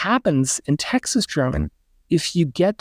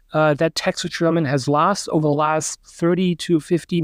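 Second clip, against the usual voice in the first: ordinary talk, nothing marked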